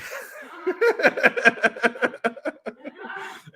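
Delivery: high-pitched burst of laughter; laughing. Real, not acted. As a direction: crack up helplessly mid-sentence. Cracks up mid-sentence.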